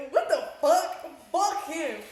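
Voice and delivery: outraged outburst. Real, not acted. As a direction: blurt it out in disbelief. Outburst about her dad.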